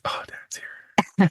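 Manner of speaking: put-on a kid's teasing hushed greeting for dad; mock awed whisper. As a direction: whisper it like the hero has arrived. Kid's relieved reaction imitated.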